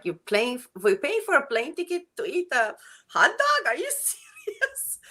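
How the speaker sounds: high, disbelieving laugh through the words; can't believe people fly for a hot dog; incredulous. Retold or imitated. Retelling disbelief at plane trips for hot dogs, laughing. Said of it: "I'm like".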